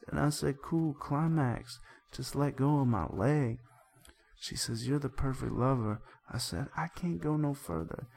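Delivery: reads the song lyrics as a hammed-up melodrama story; over-earnest storyteller delivery, lightly acted; dramatic lyric reading. Podcast host reads the song aloud as a soap opera, hammed-up.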